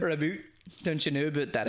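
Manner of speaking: jokey put-on Canadian with tacked-on "eh"; Canadian accent. Shown as a demonstration. Friend showing how to fake it, mock Canadian. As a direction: caricature Canadian vowels on "about". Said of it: "when you do a Canadian accent".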